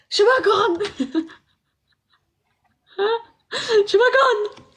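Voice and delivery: high mock Levantine question; mock Levantine accent. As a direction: keep teasing in a Levantine lilt. Repeats mock Levantine phrase. (لهجة شامية ساخرة)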